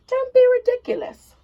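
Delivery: high, loud indignant scolding. Acted. Giant wife scolding Jack about beans, high loud rebuke.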